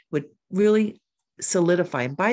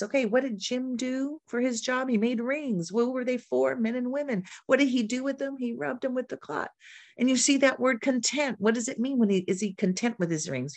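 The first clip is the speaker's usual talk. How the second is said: lightly acted classroom voice, higher pitched; teacher questioning students; 7 semitones higher than usual. Shown as a demonstration. Teacher walking students through a passage, higher questioning voice. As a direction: quiz young readers in a higher teaching voice.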